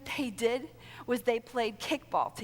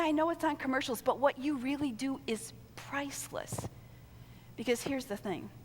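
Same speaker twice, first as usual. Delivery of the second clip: usual speaking voice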